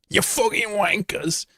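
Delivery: high, slow put-on voice; mock British insult. Teasing chat with a high, slow put-on "wankers". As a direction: ham it up like a stroppy Brit.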